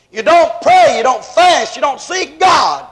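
rising preacher's cadence, hammering each clause; full-force preaching. Acted. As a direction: drive each charge home from the pulpit.

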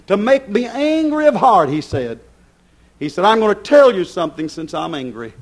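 loud, high hellfire sermon; fiery preaching. Acted. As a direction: thunder it from the pulpit. Preacher at full force.

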